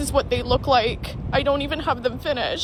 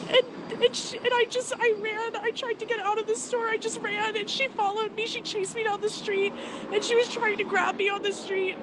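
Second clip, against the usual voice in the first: shaken; 6 semitones higher, 3 dB quieter than usual. Real, not acted. Retelling the assault, shaken.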